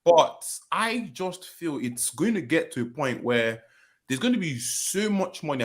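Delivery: usual speaking voice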